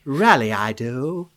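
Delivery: hammed-up Mid-Atlantic screen-star delivery; Mid-Atlantic actress accent. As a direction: play an old-movie actress with a slow Mid-Atlantic accent. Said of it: "what comes to mind with the Mid-Atlantic".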